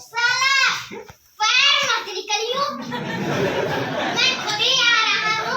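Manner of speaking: high, loud acted scolding; mock angry outburst. Husband warning his wife to stay in, mock-angry. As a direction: burst out in comic indignation.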